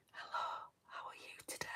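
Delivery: hushed whispered greeting. Shown as a demonstration. Ringing her sister up as an ASMR joke, whispering. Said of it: "I hate when they whisper".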